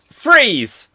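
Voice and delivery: loud, high host's shout; called-out command. Real, not acted. Host stopping the improv scene, loud shouted command. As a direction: call it out to halt everyone.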